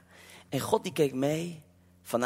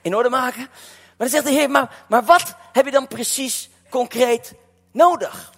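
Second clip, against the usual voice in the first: raised, loud sermon storytelling; preaching; 5 semitones higher, 6 dB louder than usual. Acted. Preacher retelling Nehemiah to the church, raised and loud. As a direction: preach it out to the congregation.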